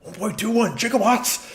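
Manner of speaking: high, frantic scientist exclamation; Doc Brown. Acted. Gamer parodies Doc over the generator, high frantic exclamation. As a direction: cry out high like an astounded inventor. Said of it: "a Doc Brown".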